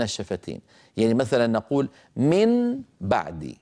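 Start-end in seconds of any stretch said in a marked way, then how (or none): 2.2-3.5 من بعد with a distinct, held N before the B; clear unmerged nun. Shown as a demonstration. Teacher shows students the heavy unmerged form, clear nun. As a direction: say من بعد with the tongue tip sounding the N. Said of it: "انطقوا نونا مظهرة".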